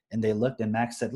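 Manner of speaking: normal talking voice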